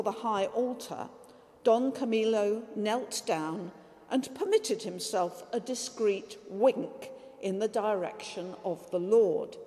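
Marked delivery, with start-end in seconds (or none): none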